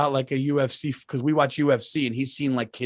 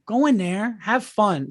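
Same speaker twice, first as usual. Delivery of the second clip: high, bright push to go have fun; dad hyping up his daughter; encouraging urging; 8 semitones higher, slower than usual. Retold or imitated. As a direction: cheer the kid into the game.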